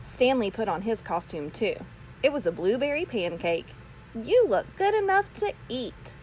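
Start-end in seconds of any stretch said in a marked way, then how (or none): 4.1-6.2 mom voice. A narrator's reading. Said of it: "Missus Lambchop said".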